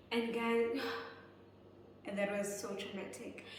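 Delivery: ordinary delivery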